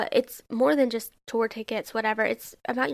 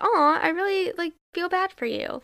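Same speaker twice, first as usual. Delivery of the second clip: high, cooing "aw" of a pitying friend; mock sympathy; 7 semitones higher than usual. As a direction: play the friend who feels sorry for you, pitch up.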